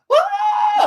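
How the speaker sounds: loud, very high startled scream; shocked scream. Retold or imitated. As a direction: scream in sudden horror on waking. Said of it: "it's like".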